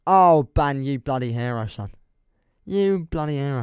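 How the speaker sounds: excited cheer. Real, not acted. Cheering a donor on stream.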